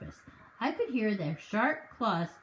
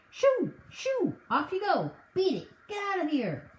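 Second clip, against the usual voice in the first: shouting shoo; 10 semitones higher than usual. Acted. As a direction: chase the birds off with high shouts. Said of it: "waving his arms at the pheasants and shouting".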